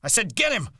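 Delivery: very high, loud, insistent yell; exasperated shouted command. A narrator's reading. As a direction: yell it as an order that must be obeyed. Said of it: "George yelled again".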